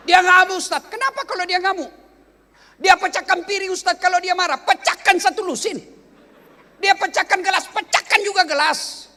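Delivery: high, loud, driving exhortation; rally the crowd at full force, building to a shout; impassioned preacher's tirade, ending in a yell; fiery preaching. Real, not acted.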